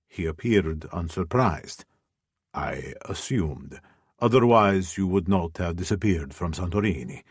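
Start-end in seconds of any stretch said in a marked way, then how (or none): 2.5-7.2 unbothered rival answering coolly; deep male voice. Acted. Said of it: "A deep male voice".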